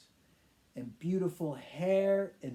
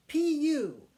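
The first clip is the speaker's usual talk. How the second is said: disgusted crowd; 10 semitones higher, 4 dB louder than usual. Acted. Crowd reacts to smelly man.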